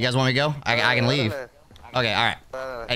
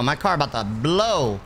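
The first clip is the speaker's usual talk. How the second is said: ordinary talk, nothing marked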